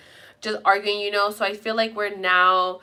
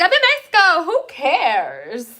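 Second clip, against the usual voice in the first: high, loud mock exclamation; retold carefree exclamation; 7 semitones higher, 6 dB louder, slower than usual. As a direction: toss it out loud, acting out your old self. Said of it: "I was like".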